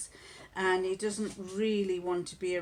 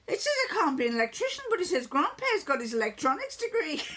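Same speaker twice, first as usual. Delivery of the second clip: indignant son; 9 semitones higher, 5 dB louder, faster than usual. Retold or imitated. Son indignant about banned jobs.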